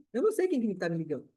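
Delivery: ordinary delivery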